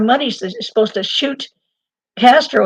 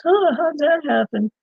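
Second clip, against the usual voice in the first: higher-pitched, feigned puzzlement; mock surprise; 6 semitones higher than usual. Government shrugs off Roselli's death, mock surprise. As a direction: play innocent, imitating officials.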